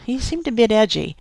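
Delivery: ordinary delivery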